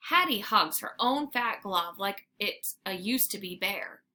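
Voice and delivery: usual speaking voice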